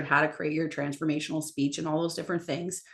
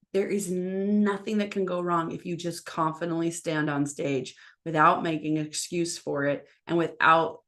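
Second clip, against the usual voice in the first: ordinary delivery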